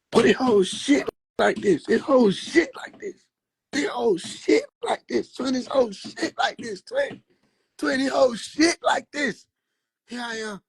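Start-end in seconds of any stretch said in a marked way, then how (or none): 0.1-9.5 high, drawn-out repeated complaint; mock exclamation. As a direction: stretch it out high, hammering every repeat. Acted repeated exclamation in a story.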